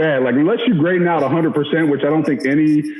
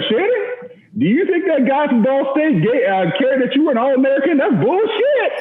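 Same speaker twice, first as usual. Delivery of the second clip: high, mocking coach voice, much higher; imitating Coach Spurrier; 10 semitones higher than usual. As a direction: mimic the coach chewing him out in film room. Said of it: "says just like this".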